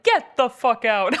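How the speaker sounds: slow, loud disbelief; stunned disbelief. Retold or imitated. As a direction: drag it out in shock. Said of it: "I'm like".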